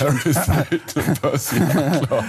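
laughing. Real, not acted. Host jokes with mock names.

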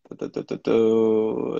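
drawn-out du-du-du on one note; idle vocal filler. Real, not acted. Host scanning for a winner, idle singsong filler. Humming while searching for a winner.

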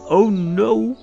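ordinary delivery, nothing marked

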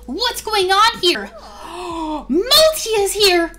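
high, loud, outraged; jealous outburst. Real, not acted. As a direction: yell it, betrayed and shocked. Jealous outburst at sleeping pair.

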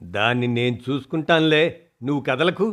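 a touch of bossy order; commanding. Acted. Daughter ordering her mother not to move, mildly bossy. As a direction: tell her firmly to stay put. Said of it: "ఆజ్ఞ జారీ చేసింది".

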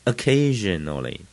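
Occasionally with light 'uh' start and strong CA; stress on CA. Shown as a demonstration. Teacher modelling the right reading, stress on CA in Occasionally. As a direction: lean on the CA in Occasionally. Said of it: "那个K D地方就重了".

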